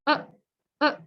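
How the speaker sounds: "uh, uh" with the air stopped in the throat; clipped throat catch on each "uh"; two short glottal "uh"s. Shown as a demonstration. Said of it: "There's like".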